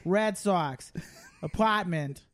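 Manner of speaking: broad Boston accent, dropped r's. Shown as a demonstration. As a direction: show off a Boston accent word by word. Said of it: "start by saying Chowder and Red Sox".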